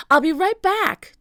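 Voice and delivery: high, loud call over distance. A narrator's reading. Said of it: "Jack called".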